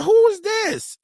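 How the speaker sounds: puzzled who-is-this; mock puzzled. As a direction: act like you can't place the voice. Confused at unknown caller.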